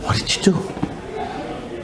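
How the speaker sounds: slow, breath-only question; hushed amazement. Retold or imitated. Wife asks rabbi about changed husband, whispering in amazement. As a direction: whisper it slowly in disbelief.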